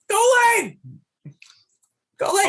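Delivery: high, loud call to a lost companion; yelling. Pretend. Player calls out to lost Coley through an avalanche, yelling. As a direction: yell out to be heard through an avalanche. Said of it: "Let's all start yelling".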